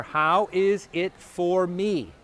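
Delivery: each word drawn out and weighed; slow, deliberate phrase. Shown as a demonstration. Teacher giving students the key phrase, slow and deliberate. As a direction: lay the phrase down slowly for them. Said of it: "So the five words are".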